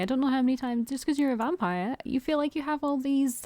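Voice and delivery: mock indignation. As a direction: scold him in pretend outrage. Streamer tells off the vampire character.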